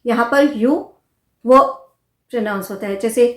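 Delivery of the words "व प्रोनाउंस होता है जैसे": ordinary talk, nothing marked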